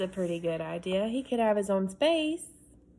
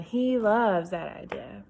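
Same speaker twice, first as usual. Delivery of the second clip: ordinary delivery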